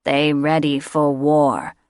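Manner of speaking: a misshapen beast answering its master; slow nasal creature reporting to master. A narrator's reading. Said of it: "the creature answered in a nasal voice".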